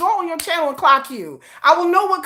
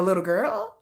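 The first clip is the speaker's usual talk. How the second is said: dropped low and soft, dismissive; condescending low jab; taunting little girl; 8 semitones lower, 6 dB quieter than usual. Acted. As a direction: sneer it down at her. Taunting a hater.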